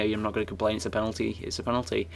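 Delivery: normal talking voice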